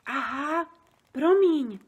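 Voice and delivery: ordinary delivery, nothing marked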